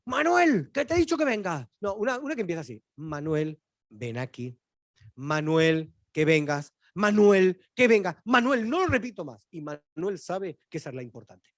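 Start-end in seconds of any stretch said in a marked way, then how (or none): 0.0-1.7 put-on high, loud mother calling her kid; impatient mom hollering "Manuel" from the start; mother yelling. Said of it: "Hay una mamá que dice". 3.0-9.5 patient mom losing her patience step by step; mother's voice building up. Retold or imitated. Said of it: "tono de voz ... ascendente".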